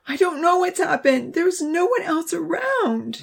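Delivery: high, tearful panic; crying. A narrator's reading. A girl over the bleeding boy, high and tearful. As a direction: near tears, voice breaking. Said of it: "someone was crying".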